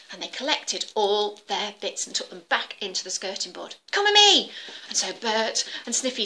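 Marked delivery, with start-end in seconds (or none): 3.9-4.5 high, loud hurry-up call; urgent call. Acted. Bert hurrying Sniffy back to the skirting board, loud. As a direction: urge your friend along. Mice fleeing with the food.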